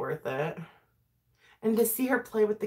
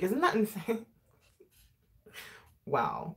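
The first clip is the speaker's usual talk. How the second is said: usual speaking voice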